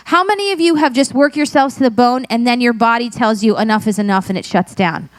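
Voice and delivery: normal talking voice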